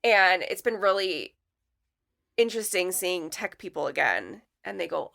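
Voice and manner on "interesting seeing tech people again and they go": ordinary talk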